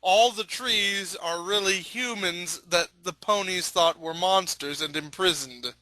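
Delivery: ordinary talk, nothing marked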